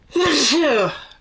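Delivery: loud sneeze-like cough; a sharp, loud sneeze; sneezing. Real, not acted. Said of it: "Excuse my sneezing".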